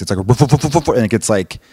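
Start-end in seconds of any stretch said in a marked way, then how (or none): 0.2-0.9 imitated woofs climbing in pitch; escalating dog barks. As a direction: mimic a dog's bark building up. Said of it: "like, escalates".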